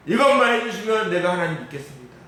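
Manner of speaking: loudly imitated pleading believer; loud bargaining prayer. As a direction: push the deal to God hard. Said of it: "매일 이런 식".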